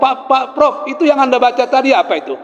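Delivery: high, hurried questioning; acting out an eager questioner. Pastor eagerly questions the professor.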